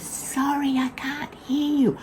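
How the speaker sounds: high, slowed mock of a careless man; lightly mocking the careless man's reply. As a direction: put on a dismissive voice. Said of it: "And he goes".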